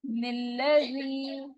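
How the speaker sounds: निल्लज़ी slowly, nun merged into lam; joined nun. Shown as a demonstration. Teacher modelling निल्लज़ी with the joined nun. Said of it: "नून को मिला के पढ़ेंगे".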